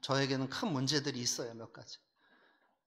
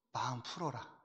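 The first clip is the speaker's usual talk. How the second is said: soft, low and tender "마음 풀어라"; gentle calming voice of God; 3 semitones lower, 10 dB quieter than usual. Retold or imitated. God gently telling the angry pastor to let go, soft and tender. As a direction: soothe him like a father calming his son. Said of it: "하나님이 뭐라 그러시냐?".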